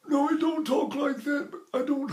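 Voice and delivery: very high voice on held breath; demonstrating breath stuck up high. Shown as a demonstration. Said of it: "hold that breath up here".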